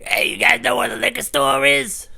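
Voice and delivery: loud, high, slow holler; big drunk dude. Retold or imitated. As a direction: imitate a drunk stranger calling out. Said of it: "he was a big dude".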